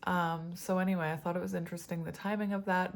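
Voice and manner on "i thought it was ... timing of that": usual speaking voice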